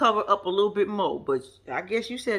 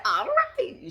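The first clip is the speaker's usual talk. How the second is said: knowing all right. Real, not acted. Reacting to Sheila's plan.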